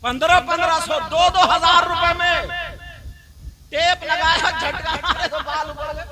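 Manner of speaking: scornful laughing. Real, not acted. Preacher ridicules parlour prices, laughing loudly. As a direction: laugh at the foolish spending. Mocking costly beauty parlour.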